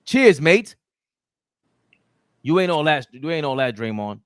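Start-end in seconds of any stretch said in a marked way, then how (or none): 0.1-0.8 mock Australian. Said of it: "He's from Australia".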